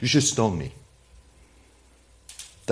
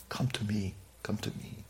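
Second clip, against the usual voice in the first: very soft, near-whispered appeal; gentle invitation; 14 dB quieter than usual. Retold or imitated. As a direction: invite them in softly, as Jesus. Voicing Jesus's gentle invitation.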